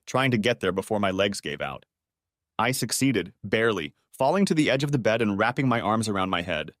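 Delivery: usual speaking voice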